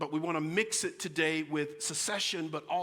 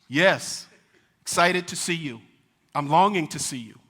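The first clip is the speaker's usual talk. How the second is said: loud, overeager, creepy keenness; eager voice; 11 dB louder than usual. Retold or imitated. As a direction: push it hard with eager intent. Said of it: "the voice says".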